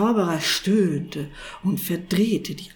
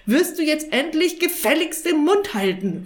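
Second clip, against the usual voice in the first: high, loud, exasperated snap; angry snapping; 9 semitones higher, 5 dB louder than usual. A narrator's reading. Sorcerer silencing the chattering cat, snapping angrily. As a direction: cut him off furiously. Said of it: "fuhr ihm der Zauberer an".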